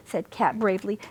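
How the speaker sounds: ordinary delivery, nothing marked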